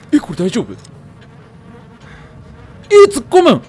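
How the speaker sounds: ordinary talk, nothing marked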